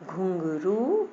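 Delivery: घुंघरू slow, with a long final रू; drawn-out word reading. Shown as a demonstration. Teacher shows the long vowel, drawn out. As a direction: stretch out घुंघरू.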